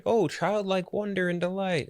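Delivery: high, drawn-out dreamy awe; mock wonder. Film fan voicing the movie's childlike delight, in high mock wonder. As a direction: voice childlike wonder, slow and lifted. Contrasting the movie's wide-eyed tone.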